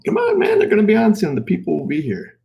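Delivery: high, loud, playful pleading; hyped urging. Real, not acted. Dad rushing child before viewers arrive, hyped urging. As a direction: start very high and loud, then come down.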